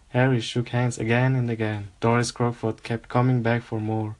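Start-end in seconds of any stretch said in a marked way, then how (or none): none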